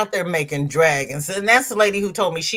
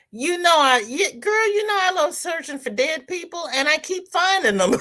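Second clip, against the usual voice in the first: high mock reading; 11 semitones higher than usual. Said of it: "Marsha Lee says, she's laughing at me".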